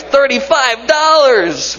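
high, slow drawn-out amazement; mock astonishment. Real, not acted. Pastor reading baby shoe price tag, mock amazed and drawn out. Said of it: "This is really funny".

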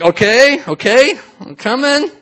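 high, rising, nervous okays; tentative Peter. Acted. Peter dipping a foot in the water, tentative.

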